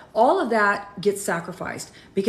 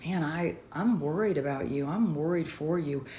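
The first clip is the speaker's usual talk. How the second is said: calm concern; 2 semitones lower, 7 dB quieter than usual. Retold or imitated. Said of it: "And, and just so calm".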